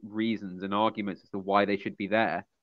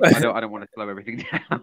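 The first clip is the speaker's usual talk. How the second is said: loud, quick laughing protest; 9 dB louder, much faster than usual. Real, not acted. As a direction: say it through a laugh, hurried. Embarrassed laugh over lost train of thought.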